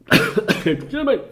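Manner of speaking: harsh cough then strained apology; raspy cough. Real, not acted. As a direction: cough hard, croak an excuse. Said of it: "I apologize for that".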